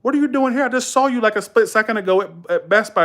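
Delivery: high-pitched mimicked disbelief, easing down at the end; astonished customer. Customers confronting him at his Walgreens job, astonished. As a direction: imitate a baffled customer, pitched well up. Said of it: "people come in and go".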